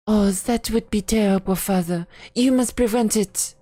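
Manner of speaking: a hint of a worried daughter begging; lightly voiced daughter. A narrator's reading. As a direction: daughter pleading with her father to act.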